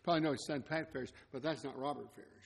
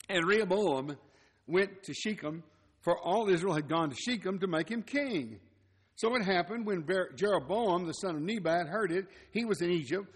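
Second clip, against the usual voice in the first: measured, declaiming Bible reading; scripture reading; 5 dB louder than usual. Acted. Preacher reading Second Chronicles aloud to the church, declaiming. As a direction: read the passage out to the congregation.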